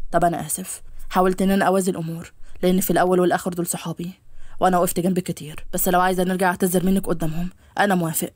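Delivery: low male character voice, conciliatory; apologetic young man. Acted. (صوت شاب منخفض يعتذر)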